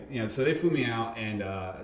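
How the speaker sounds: ordinary talk, nothing marked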